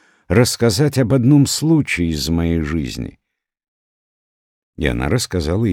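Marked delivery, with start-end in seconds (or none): none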